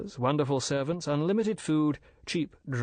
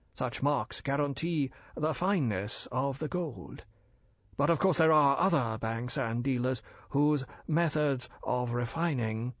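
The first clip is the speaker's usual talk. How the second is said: polite and bland, a dealer explaining; bland Chinese merchant; 3 semitones higher than usual. Acted. Older Fu brother lecturing the major, smooth and polite.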